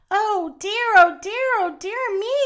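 gently hammed-up dismay; worried. A narrator's reading. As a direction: fret aloud in a playful, put-on worry. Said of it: "said worried Mister Horse".

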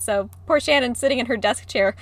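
ordinary talk, nothing marked

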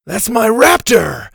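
put-on high, loud, drawn-out kid's shout; distraught kid yelling; upset shout. A narrator's reading. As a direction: shout it over a hurt pet. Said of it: "Ash shouted".

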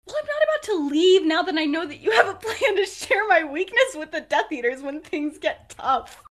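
high, loud retold protest; mock indignant. Refusing to leave after learning of the plan, mock indignant. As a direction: start high and forceful, easing off toward the end.

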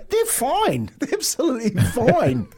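hammed-up defensive whine up high; husband insisting shorts are fine, mock high; mock protest. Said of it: "I'm going".

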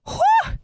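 short, very high "whew" whoop; acted whoop of relief; overwhelmed whew. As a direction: let out a quick high-pitched whew. Reenacts being overwhelmed.

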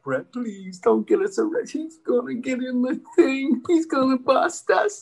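rising high mock worry; pleading brother. Brother begging Brett near the cop, high mock pleading. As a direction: imitate a panicky brother, laugh creeping in. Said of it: "my brother's like".

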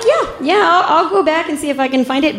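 high-pitched, stammered yes; reluctant agreement. Retold or imitated. Officer agreeing to hunt for an eyeball, reluctant. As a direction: agree uneasily, starting high and easing down.

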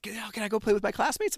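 put-on high, fast, loud kid's plea; eager child. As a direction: rush it like an excited boy. Son asks eagerly.

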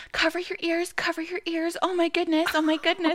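high, loud, panicky mock outcry; hammed-up shocked pearl-clutching; scandalized mother. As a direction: imitate a mother frantically shielding children's ears. Mimics scandalized mother shielding kids.

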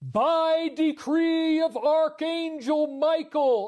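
big, slow heroic proclamation. Shown as a demonstration. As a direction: proclaim it like a triumphant hero. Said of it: "I'll say it in the hero voice".

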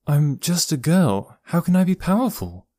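high-pitched bewildered protest; incredulous. Acted. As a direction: question it in disbelief, pitched well up. Niece disbelieving uncle's revelation.

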